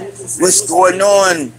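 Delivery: playful put-on Godfather voice; Godfather imitation. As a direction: stretch it like a movie mobster. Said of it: "The Godfathers".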